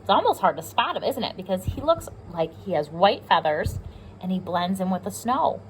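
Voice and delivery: ordinary talk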